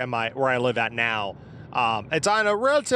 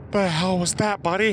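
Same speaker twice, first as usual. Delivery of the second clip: voicing the dog; 5 semitones higher than usual. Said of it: "He's just looking at me going".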